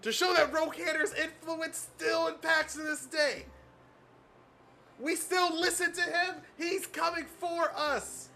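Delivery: high, loud fanatic prisoner; zealous cultist voice. Acted. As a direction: preach it like a true believer.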